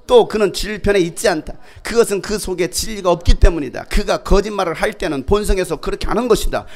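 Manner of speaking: loud, declamatory Bible recitation; projected, measured scripture reading; scripture reading aloud. Real, not acted. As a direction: lead the congregation in reading. Leads congregational scripture reading.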